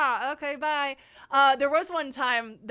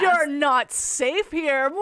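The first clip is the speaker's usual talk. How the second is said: slow, louder spooky threat; slow mock menace; 3 semitones higher, 9 dB louder, much slower than usual. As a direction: draw it out like a haunt. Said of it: "menacing reply".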